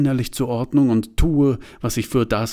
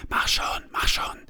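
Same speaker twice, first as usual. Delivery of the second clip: soft unvoiced urging; urging whisper; 4 dB quieter than usual. A narrator's reading. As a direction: whisper it, pushing him on. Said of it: "flüstert sie". (drängend geflüstert)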